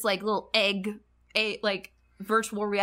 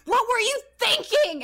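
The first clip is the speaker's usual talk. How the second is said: much higher, louder, hammed-up shocked scolding; scolding inspector; 5 dB louder than usual. Inspector scolding Ai after she fires the gun, shocked high scolding. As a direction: bark out a shocked reprimand. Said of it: "the inspector is just like".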